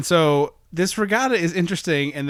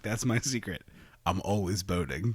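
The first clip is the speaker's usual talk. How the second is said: low quiet reveal; 6 semitones lower, 9 dB quieter than usual. Retold or imitated. Hulk quote, low quiet.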